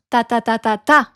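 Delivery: French rhythm, four even "ta"s then a strong "ta"; last-syllable stress. Shown as a demonstration. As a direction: land hard on the fifth "ta". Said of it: "you stress la dernière syllabe".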